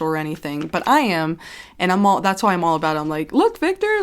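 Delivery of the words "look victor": playful teasing call. Retold or imitated.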